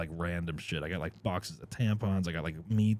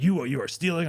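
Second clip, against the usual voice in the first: mock accusing lady; 7 semitones higher, 3 dB louder than usual. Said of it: "she's just like".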